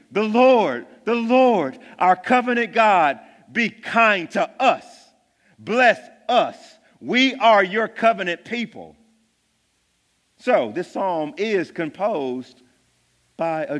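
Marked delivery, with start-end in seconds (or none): 0.1-9.0 louder, higher pleading prayer; fervent prayer. Acted. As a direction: cry out to God as the psalmist. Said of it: "he's actually saying".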